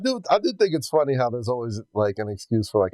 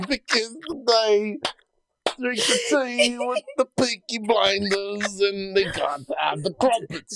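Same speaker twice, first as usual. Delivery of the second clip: high, slow, drawn-out comic impression; hammed-up impression attempting a British accent; Bill Cosby; 8 semitones higher, slower than usual. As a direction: stretch the words in a goofy, badly faked British accent. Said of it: "that's Bill Cosby trying to do".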